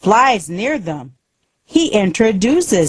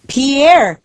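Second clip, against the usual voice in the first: calling Pierre, higher and louder; 7 semitones higher, 3 dB louder, slower than usual. Acted. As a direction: call across the vineyard. Julie calls Pierre over.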